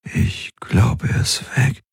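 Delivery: hoarse whisper, rising on weg; raspy whisper. Acted. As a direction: breathe it out, dazed. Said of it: "war seine Stimme rau".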